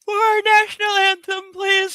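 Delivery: high, loud, stretched by a yawn; real yawn pushing the voice up high; talking through a yawn. Real, not acted. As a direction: yawn your way through the announcement. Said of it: "Sorry, I'm yawning".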